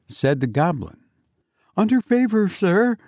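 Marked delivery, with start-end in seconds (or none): 1.8-3.0 high, nervous babbling; frightened. A narrator's reading. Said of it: "replied the horror-stricken sexton".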